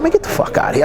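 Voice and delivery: imitating disbelief. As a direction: retell his stunned reaction in a high voice. Dame reacting in disbelief.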